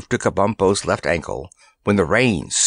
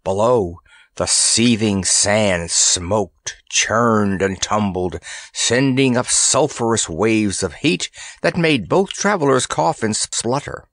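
plain reading voice

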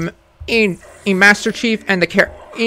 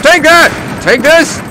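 battle taunts; 7 semitones higher, slower than usual. Acted. Taunting enemies while fighting.

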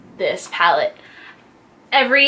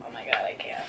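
barely voiced, overwhelmed whisper; hushed, breath-only disbelief; awestruck whisper; 14 dB quieter, faster than usual. Real, not acted. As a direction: whisper it as if too overcome to speak. Overcome by the palette.

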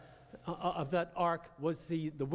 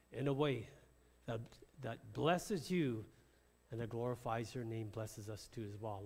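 prayer; 6 semitones lower, 6 dB quieter than usual. Acted. Leads the congregation in prayer.

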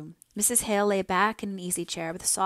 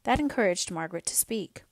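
usual speaking voice

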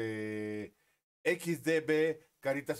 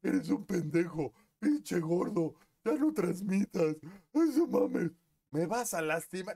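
higher, louder hater mock; mock hater insults; 6 semitones higher, 5 dB louder than usual. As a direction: bark insults like a troll. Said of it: "por decirme".

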